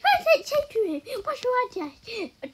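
put-on high, squealing lovestruck girl; mock Bacon Girl voice. Acting a Roblox girl smitten with a boy, high gushing. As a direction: gush in an exaggerated squeaky voice. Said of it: "Bacon Girl be like".

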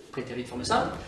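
ordinary delivery

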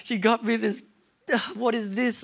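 hammed-up mopey child voice; whiny sulk. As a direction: sulk like a kid near tears. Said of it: "crying in my room".

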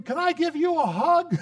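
put-on high, eager child asking for a hug; little girl. Little girl asking the elderly person for a hug, high and eager.

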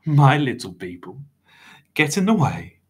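high, affronted disbelief; scandalised high voice; shocked. A narrator's reading. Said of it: "sounded shocked".

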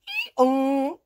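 loud mock hee-haw; donkey bray. A narrator's reading. Storyteller making the donkey's noise, loud bray.

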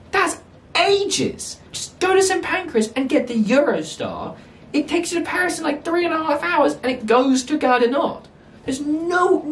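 incredulous rant. Real, not acted. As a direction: spell out the absurd journey in high-pitched protest. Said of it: "that was a pretty long rant".

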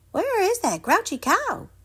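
high wondering character voice; curious little goat. Acted. Little Dude sneaking up to the pen, high and curious. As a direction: peek and wonder aloud. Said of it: "he wondered".

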